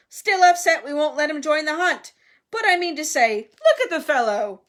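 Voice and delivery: loud, hearty, dismissive bluster; shouting. A narrator's reading. Sir Patrick mocking Nick before the guests, shouting. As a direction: boom it out mockingly. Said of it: "shouted Sir Patrick's head".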